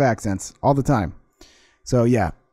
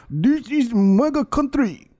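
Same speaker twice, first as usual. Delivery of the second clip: high, drawn-out mock Nigerian accent; slow, exaggerated Nigerian accent, pitched way up; 11 semitones higher, much slower than usual. Shown as a demonstration. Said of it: "It's probably pretty close to Nigerian".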